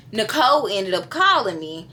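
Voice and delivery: ordinary delivery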